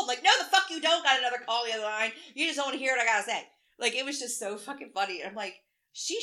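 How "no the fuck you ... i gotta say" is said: loud, fast comeback; mock-quarrel outburst, rapid and loud; acted-out angry retort. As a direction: fire back indignantly, quick and loud. Acted quarrel over phone call.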